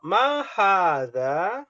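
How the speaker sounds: slow, drawn-out question; slow model question. Shown as a demonstration. Teacher drills the Arabic question slowly. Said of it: "Ana bertanya".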